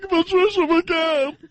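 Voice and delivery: very high, drawn-out parody; exaggerated ad reader stretching her name; Patricia McCann. Host showing how her reads sound, high hammed-up voice. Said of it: "they're always like this".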